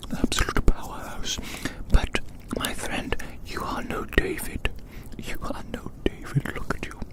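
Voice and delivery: usual speaking voice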